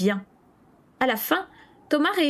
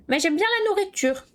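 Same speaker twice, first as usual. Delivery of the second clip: put-on higher, contented child voice; little boy voice; 10 semitones higher than usual. A narrator's reading. Matt says this while helping himself, lightly voiced. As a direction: say it like a happy kid. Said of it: "ajouta-t-il".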